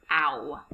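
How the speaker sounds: the sound "ow", mouth opening wide; ow vowel sound. Shown as a demonstration. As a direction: say "ow" as in cow, isolated. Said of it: "make a special vowel sound".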